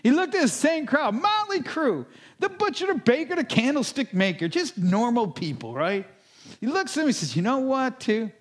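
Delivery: high, ringing sermon voice that builds; preaching at full force. Acted. Pastor to his congregation, preaching at full force. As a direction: lift the room with each line about light. Preaching about salt and light.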